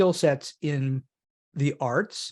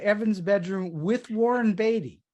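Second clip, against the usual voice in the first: real incredulity; 6 semitones higher than usual. Real, not acted. Host repeating her Robert Evans story back, astonished.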